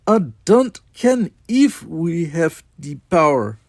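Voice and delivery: slow, high, loud mock Scottish engineer with Russian accent. A narrator's reading. Chekhov playing engineer to captain, weird Scottish-Russian accent. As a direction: lay a weird Scots brogue over a Russian accent. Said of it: "kept up that weird accent on accent".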